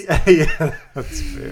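laughing. Real, not acted. Laughs at the joke.

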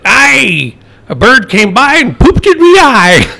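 hammed-up pirate punchline. Pirate joke, pirate voice.

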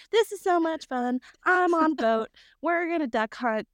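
high-pitched mock glee; hammed-up, singsong delight; giddy excitement. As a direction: squeal it like a thrilled novice. Retold giddy excitement on the boat.

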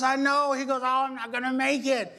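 mock despairing whine.

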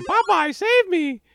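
high cry for help; mock yell for help. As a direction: scream for rescue from the tube.

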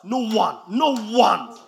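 preacher quoting Jesus, loud and insistent; loud emphatic quote. Acted. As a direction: pound out "no one" each time. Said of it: "He says".

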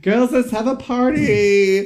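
high, loud drawn-out party squeal; hammed-up drunk mum shriek. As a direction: whoop it like a tipsy mum butting in. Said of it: "the drunk mum's".

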